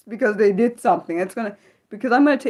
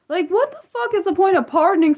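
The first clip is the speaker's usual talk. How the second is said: outraged outburst; 11 semitones higher than usual. Real, not acted. Vlogger to viewers about a pardon, outraged high outburst. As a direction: pitch shoots way up in disbelief.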